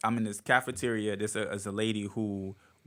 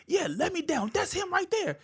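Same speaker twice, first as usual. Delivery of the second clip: much higher mom voice; mom's voice. Retold or imitated. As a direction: point him out like a proud mother. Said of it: "she like".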